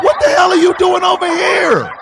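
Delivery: high, incredulous mock shout; astonished outcry. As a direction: exclaim it high in disbelief. Astonished question to the woman.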